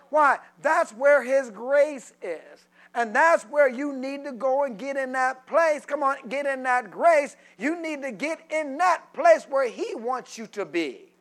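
loud, rising sermon cadence; preaching at full force. Acted. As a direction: preach it to the congregation.

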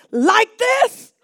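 put-on excited kid. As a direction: ask it like a thrilled boy. Boy testing his healed leg.

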